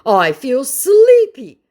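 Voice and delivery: high, loud, slow fake sleepiness; mock scammer voice. Vlogger mocking the scammer's excuse, slow and high. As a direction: drag it out high like a fake yawn. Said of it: "trying to run away".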